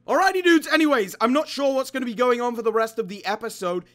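upbeat host sign-off; youtuber outro. Acted. Youtuber addressing viewers in upbeat host voice. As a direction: wrap up the episode for viewers.

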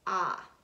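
Ah as a short open ah, the short O; short O sound. Shown as a demonstration. Teacher modelling the short O for the class, clear demo. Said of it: "the short sound is gonna sound like this".

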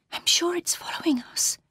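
high, soft, fearful whisper; frightened whisper. A narrator's reading. Laura to Marion as they flee the figure, frightened whisper. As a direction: whisper it urgently, afraid of being heard. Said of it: "whispered Laura".

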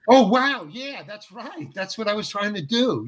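high, hushed wonder; caught off guard. Retold or imitated. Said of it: "I wasn't ready for that".

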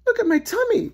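high, loud complaint; distressed lady. A narrator's reading. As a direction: wail it in a high lady's voice.

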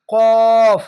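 কফ said heavy and loud, no a sound; thick heavy letter. Shown as a demonstration. Teacher showing the heavy letter, said thick. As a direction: thicken কফ in the throat. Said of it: "এখানে মোটা করে হবে".

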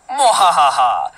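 slow, hammed-up villain cackle; drawn-out pretend villain laugh; mock evil laugh.

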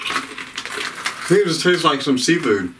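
talking while chewing cracker; chewing crunchy snack mid-sentence; mouth full, chewing. Real, not acted. Seen 2: Holds cracker until 4.1, then bites; chewing visible from 4.6 on.

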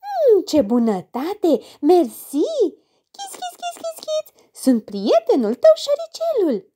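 very high, squeaky mouse voice; thin little voice. Acted. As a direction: squeak like a tiny cheerful mouse. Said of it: "vocea subțire".

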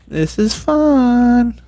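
high, very stretched-out fun; drawn-out glee. Pretend. Player amusing themself collecting parts, drawn-out glee. Playful exclamation while collecting.

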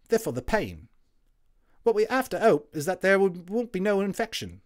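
a WWI orderly waking up chatty; friendly Cockney Tommy, loud and high; cheery Cockney medic. Acted. Tommy gives pills.

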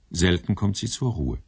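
ordinary talk

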